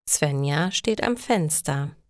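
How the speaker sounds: plain reading voice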